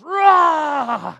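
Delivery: loud high mock roar at a bully; retold kid's roar, loud and high. Nephew confronts bully, retold.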